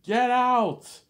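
high, loud screaming rage; father disowning the boy, screaming high and loud; furious dad. Retold or imitated. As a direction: scream it down at the son like a raging father. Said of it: "it's like".

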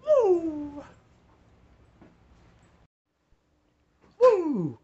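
high, loud woo; whooping cheer. Acted. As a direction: whoop it like a hype intro. Intro song cheer.

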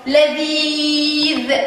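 لذيذ with the middle ee held very long; slow demonstration of لذيذ, sustaining the ee; long vowel. Shown as a demonstration. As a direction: draw out the ee in لذيذ. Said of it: "فيها مد بالياء".

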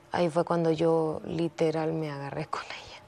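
whispered, as if admitting something shameful; whispered confession. Real, not acted. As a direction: drop to a whisper for the worst part. Confesando la pelea con su madre. (confesión susurrada)

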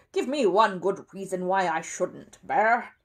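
loud, slow demand from a cross neighbour; gruff challenge. A narrator's reading. Mr Curry challenging Paddington over the rubble, gruff. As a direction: demand a reason, landing hard on the last word.

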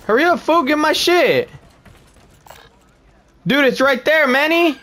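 panicking gamer. Real, not acted. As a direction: frantically hurry a teammate. Urging teammate mid-fight.

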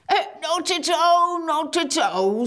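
bow and wave it off, giant-style; polite giant. A narrator's reading. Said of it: "said the giant politely".

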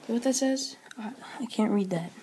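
whisper. Real, not acted. Whispering to viewers off-camera.

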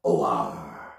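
very low pirate "arr"; pirate growl. A narrator's reading. Storyteller as the pirate setting sail with the loot, low pirate growl. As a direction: drop right down into a pirate's growl. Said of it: "and he said".

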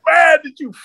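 high, laughing retelling of a teammate. Retold or imitated. Teammate marvels at the beaten guy, laughing. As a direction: crack up as the amazed teammate. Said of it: "He goes".

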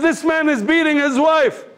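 high-pitched alarmed report, acted out; urgent appeal. Telling the guard about a beating, urgent re-enactment.

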